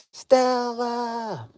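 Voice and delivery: high, long drawn-out name call; mock calling out.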